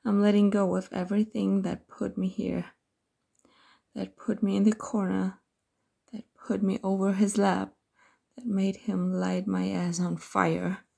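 plain reading voice

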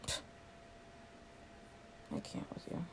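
whispered under the breath; barely audible, voiceless aside; exasperated whisper. Acted. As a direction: mutter it to yourself, fed up. Muttered exasperation at coworker.

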